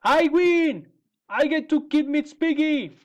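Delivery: high, loud gleeful gloating; triumphant. Acted. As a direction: crow over the victory. Witch gloats over winning.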